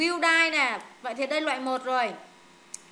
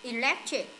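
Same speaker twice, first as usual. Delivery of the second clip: "Electric" with weight on LEC; stress on second syllable. Shown as a demonstration. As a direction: lean on the middle of Electric. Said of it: "Electric nhấn âm hai này".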